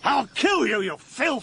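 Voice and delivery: high, strained furious threat; enraged, voice pitched right up; murderous rage. Acted. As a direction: lunge at him with the voice. Threatening the brother's killer.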